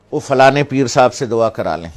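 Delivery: loud, urging imitation; mock people rushing for blessings; mimicking eager devotees. Mimicking people running after saints.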